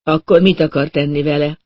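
ordinary delivery

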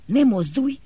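loud, high order to freeze; loud, sharp freeze command. Acted. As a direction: snap out the order. Doctor orders girl to freeze.

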